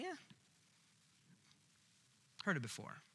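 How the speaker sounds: soft, jaded and dismissive; bored shrug. Acted. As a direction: shrug it off like old news. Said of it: "we're like".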